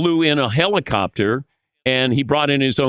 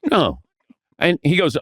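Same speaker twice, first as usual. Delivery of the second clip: usual speaking voice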